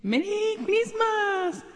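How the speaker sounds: very high, strained cute voice, drawn out and loud; raspy cute bunny. Acted. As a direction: squeak it out as a rabbit with no voice left. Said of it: "どんだけガスガスなんだよ". (カスカスの可愛いうさぎ声)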